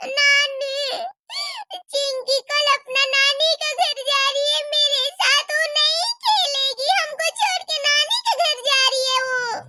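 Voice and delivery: put-on crying child. Child complains crying to grandmother.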